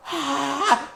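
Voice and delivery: high, soft laughing "ah"; high cry breaking into a laugh; imitated burst of laughter. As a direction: re-enact an outburst of hilarity. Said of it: "it wasn't like laughing. I was like".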